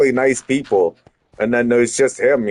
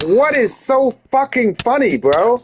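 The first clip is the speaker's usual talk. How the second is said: high-pitched, incredulous demand; indignant challenge; 10 semitones higher than usual. Retold or imitated. Speaker confronting the man who starts smiling, indignant challenge. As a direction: challenge them in high, outraged disbelief.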